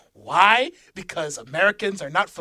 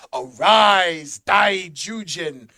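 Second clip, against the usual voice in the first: announcer voice; 9 dB louder, much slower than usual. Acted. Announcing episode title.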